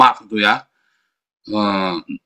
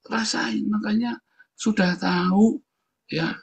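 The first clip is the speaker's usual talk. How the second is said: gloating whisper; 7 semitones higher, 5 dB quieter, slower than usual. Real, not acted. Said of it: "gloating at enemies".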